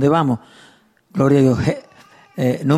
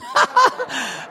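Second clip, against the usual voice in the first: high laugh; 4 dB quieter than usual. Real, not acted. Laughing at his memory.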